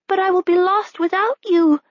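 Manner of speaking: high anguished plea; pleading cry. A narrator's reading. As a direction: cry out against being left behind. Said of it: "Princess Nell cried".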